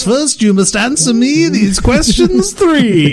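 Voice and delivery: high, slow, solemn gatekeeper; riddle keeper voice. Pretend. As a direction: intone it like a bridge guard. Quest riddle before using bathroom.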